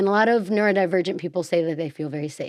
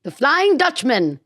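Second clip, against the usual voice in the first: mock ghostly drawn-out "Flying Dutchman"; hammed-up spooky cartoon ghost; 5 semitones higher than usual. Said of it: "the character in SpongeBob that's like".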